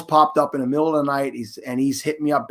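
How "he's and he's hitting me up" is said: ordinary delivery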